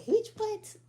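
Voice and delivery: high loud shocked cry; incredulous high-pitched cry; shocked outburst. Real, not acted. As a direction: yelp it in disbelief. Shocked reaction to own retelling.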